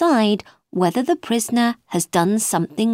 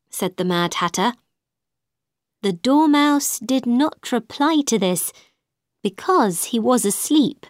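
plain reading voice

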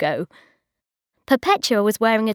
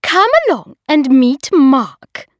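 loud, much higher nagging voice; bossy hostess; 6 semitones higher, 8 dB louder than usual. A narrator's reading. Hostess nagging Bridget about marriage, high and loud. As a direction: lecture her like a bossy aunt. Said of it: "said Una".

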